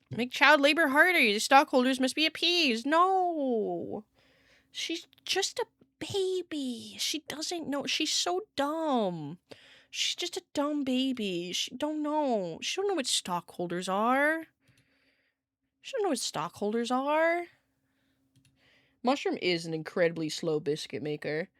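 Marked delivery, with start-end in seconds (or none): 0.1-4.1 higher, louder mock executive voice; mock corporate villain. 4.7-17.4 put-on baby talk about cat. Baby-talks about her cat.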